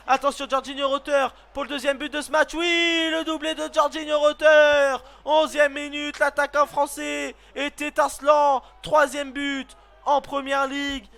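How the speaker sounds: loud, high goal shout; goal call. Real, not acted. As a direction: celebrate the double at full voice. Second goal scored, commentary.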